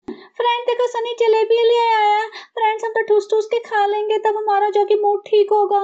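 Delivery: high, gushing vlog voice; fake-sweet show for the camera; mock vlogger wife. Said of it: "नौटंकी मचा दी". (ऊँची, इतराती vlog वाली आवाज़)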